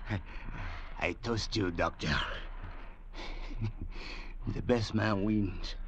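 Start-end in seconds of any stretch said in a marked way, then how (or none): 2.1-4.5 gloating laugh. Acted. The sailor gloats over the doctor.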